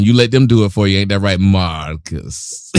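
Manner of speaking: low teasing movie-character voice, drawing out the last words; Lady Eloise. Acted. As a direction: slide down low and drag out the name Marcus. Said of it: "Why'd you say it like that?".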